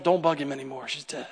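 low, soft and heavy; grim news. Retold or imitated. Servant telling Jairus his daughter died, low and soft. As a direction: break it quietly, no hope left. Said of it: "His servant comes, taps on the shoulder".

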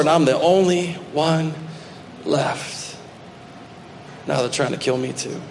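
trailing off to a near-whisper on "left"; broken, fading. Acted. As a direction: let it die away, defeated. Same answer, now fading out.

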